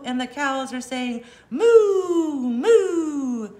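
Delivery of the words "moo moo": loud high drawn-out mooing; cow moo. Acted. Reading a farm book, the cows moo loudly.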